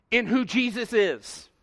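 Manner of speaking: ordinary talk, nothing marked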